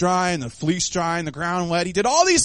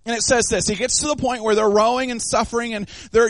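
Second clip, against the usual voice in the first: normal talking voice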